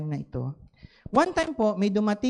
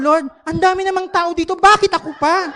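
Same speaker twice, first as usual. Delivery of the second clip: loud, high whining protest; louder, higher "bakit ako pa?"; mock complaint; 9 semitones higher, 9 dB louder than usual. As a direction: complain to God with comic outrage. Complaining to God, crying earlier.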